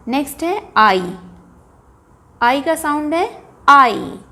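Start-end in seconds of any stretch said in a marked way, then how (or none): none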